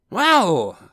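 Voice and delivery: loud, high, drawn-out opener; boastful strongman. A narrator's reading. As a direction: launch grandly into a boast. Boastful nephew begins his reply.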